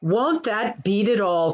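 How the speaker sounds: usual speaking voice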